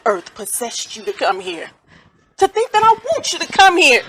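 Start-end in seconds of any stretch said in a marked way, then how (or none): 2.4-4.0 higher and louder disbelief; can't believe her nerve; indignant outburst. Acted. Grieving mother indignant at the stranger on her porch.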